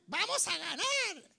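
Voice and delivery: slow, squeaky high cheer; too-high voice. Acted. Mocking a team with no menace, high-pitched chant. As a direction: stretch the war cry out in a silly high voice. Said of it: "una voz demasiado aguda".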